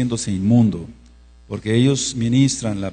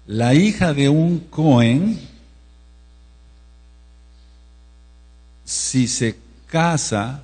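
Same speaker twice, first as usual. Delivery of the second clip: slow emphasis; 2 semitones higher, much slower than usual. Acted. Preacher stressing a key rule.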